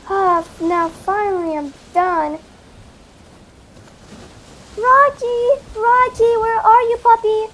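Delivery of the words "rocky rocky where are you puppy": high, loud call for the dog; calling the puppy, higher and louder. Acted. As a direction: shout across the beach for Rocky.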